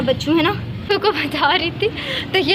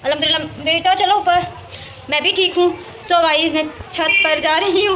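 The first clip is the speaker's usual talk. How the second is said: ordinary talk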